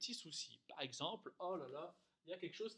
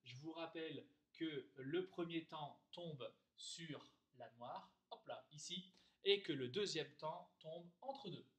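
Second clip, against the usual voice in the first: usual speaking voice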